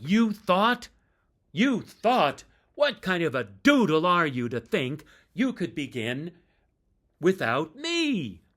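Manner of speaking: loud, high incredulous scolding; mock indignation. Bandmate mocking the singer in front of the crowd, loud indignation. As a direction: fling the question back in disbelief. Rich berating narrator onstage.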